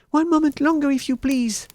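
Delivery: very high, loud begging; frantic plea. A narrator's reading. Wife stalling the threatening Bluebeard, frantic high plea. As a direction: beg for time at a high pitch.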